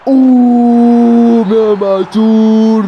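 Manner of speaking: long, loud, high drawn-out roar; goal celebration shout. Real, not acted. Gamer roaring Imam Ashour's goal, real excited shout. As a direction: stretch the cry and the scorer's name at full voice.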